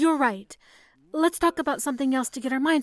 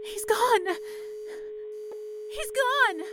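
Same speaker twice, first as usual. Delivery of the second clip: each repeat climbs higher into anguish; building grief; 8 semitones higher, slower than usual. Acted. Operator realizing Richard is lost, rising anguish. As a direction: let it break more each time.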